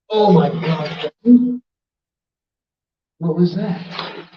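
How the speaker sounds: slow, startled oh my God; startled gasp. Real, not acted. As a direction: caught off guard in the dark. Startled in the dark hole.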